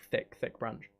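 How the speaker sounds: ordinary delivery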